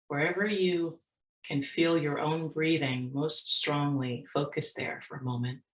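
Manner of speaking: soft, unhurried meditation voice with long pauses; guided meditation. Real, not acted. Speaker leading workshop audience in mindfulness practice, guided meditation. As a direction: guide listeners gently into their breath, leaving space.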